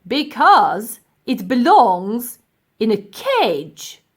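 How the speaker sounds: slow, pompous professor; a haughty old professor, slow and loud. Acted. Professor lecturing the ladies, slow pompous voice.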